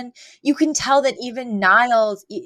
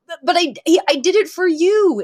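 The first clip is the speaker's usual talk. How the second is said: stammering, higher-pitched mock outburst; losing it; 8 semitones higher, 4 dB louder than usual. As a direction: act out losing your composure, voice jumping up. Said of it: "Mister Sheffield kind of loses it".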